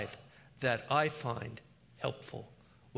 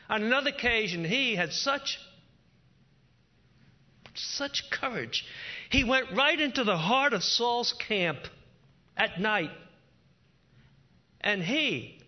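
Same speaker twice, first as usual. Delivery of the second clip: high, loud impassioned sermon; fervent preaching; 8 semitones higher, 6 dB louder than usual. Acted. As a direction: build it with awe and fire. Preaching rises again.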